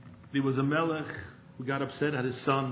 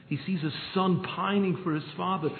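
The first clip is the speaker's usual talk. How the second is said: ordinary talk, nothing marked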